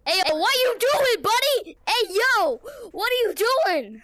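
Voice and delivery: high, loud call to a stranger. Real, not acted. Kid calling loudly to another player in game. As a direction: hail them from across the map.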